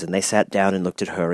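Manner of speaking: ordinary delivery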